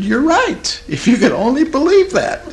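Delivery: high, emphatic retold cry; echoed wail. Therapist answering the crying client, echoing her wail. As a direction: throw the wail back, high-pitched.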